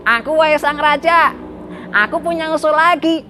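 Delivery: high, squeezed minister's voice; eager minister. Acted. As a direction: pipe up high, volunteering to the king. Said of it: "menteri yang sama".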